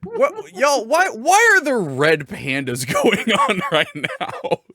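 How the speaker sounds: high, slow baffled voice; puzzled high squeak; confused viewers. Retold or imitated. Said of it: "I saw a lot of people going".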